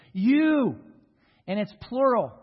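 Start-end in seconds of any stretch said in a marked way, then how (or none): 0.1-0.7 "You" said much higher and louder, alone; a single booming "You"; emphatic YOU. Shown as a demonstration. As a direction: ring out "You" as the whole point. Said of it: "it gives special emphasis".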